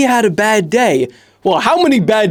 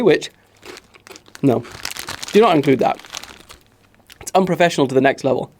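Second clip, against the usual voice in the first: normal talking voice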